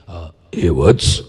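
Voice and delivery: slow, deep echoing call from the sky; deep booming God voice. Retold or imitated. God calling to a man at the station, deep and booming. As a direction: boom it out like God speaking from above. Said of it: "એકદમ બેઝમાં અવાજ આવ્યો".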